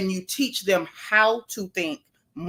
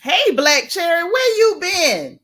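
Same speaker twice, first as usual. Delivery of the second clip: higher, louder, slow drawn-out hello; excited greeting; 7 semitones higher, 5 dB louder, slower than usual. Real, not acted. Greeting a returning viewer.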